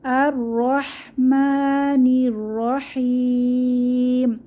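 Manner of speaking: slow chanted verse to read along; drawn-out tajweed reading; Quran recitation. Shown as a demonstration. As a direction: recite slowly together. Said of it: "একসাথে পড়ি".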